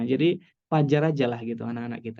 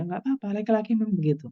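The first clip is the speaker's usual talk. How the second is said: quick, higher brush-off of the worry; reassuring; 7 semitones higher, faster than usual. Acted. Lecturer answering an anxious mother, quickly and reassuringly. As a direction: wave the worry away brightly. Answering a parent's worried question.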